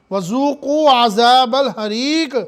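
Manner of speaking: slow chanted verse recitation; Quranic recitation. Acted. Preacher reciting the verse to the congregation, slow chant. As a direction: draw out the verse like tajweed.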